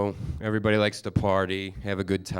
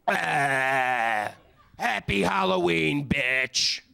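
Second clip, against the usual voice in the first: put-on slow, high, slurring drunk rant; drunk blackout rant; 5 semitones higher, slower than usual. Blackout rant at passed-out girl.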